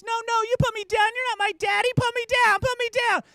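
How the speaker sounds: put-on very high, loud kid's tantrum screech; screaming child. Son screaming in the parking lot, high tantrum screech. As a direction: shriek it like a flailing toddler. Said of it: "screaming at the top of his lungs".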